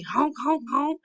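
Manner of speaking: playful high honk imitation; high horn honks. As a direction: toot it like a toy car horn. Rex honking his horn.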